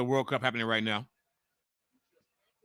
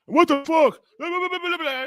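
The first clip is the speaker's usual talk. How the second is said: high, squeaky mock rant; mocking the troll; 6 dB louder than usual. Streamer mimicking a troll's cussing rant, high squeaky parody. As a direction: parody his whining, much higher. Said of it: "He just started cussing and shit".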